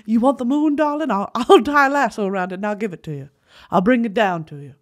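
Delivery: put-on old-movie darling-wooing voice. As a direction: croon it like a romantic film star. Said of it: "That's literally [name]".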